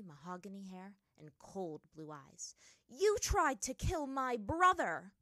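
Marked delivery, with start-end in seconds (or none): 2.9-5.1 high, loud, drawn-out accusation; angry accusation. A narrator's reading. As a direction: hurl the accusation at an enemy. Furious sister confronts her brother's attacker.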